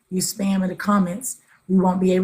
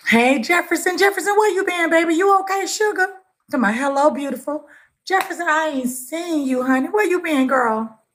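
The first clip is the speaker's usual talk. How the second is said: sing-song greeting, much higher; affectionate high greeting; 6 semitones higher than usual. Real, not acted. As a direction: call out to her like a long-lost friend.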